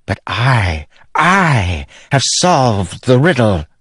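queen proudly revealing the spren bond; gloating queen. Acted. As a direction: relish the secret. Queen boasts.

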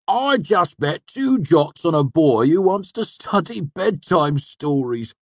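high, loud indignant complaint; grouse, dragging out the last words scornfully; grousing. A narrator's reading. Manet complains about his bet, grousing. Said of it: "Manet groused".

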